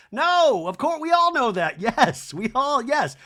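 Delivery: high, loud sarcastic outburst; hammed-up emphatic agreement; mock exasperation. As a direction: exclaim it as if stating the obvious. Mock outburst at Sutton's supposed revelation.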